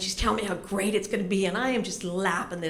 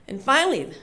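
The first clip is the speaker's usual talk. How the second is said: ordinary delivery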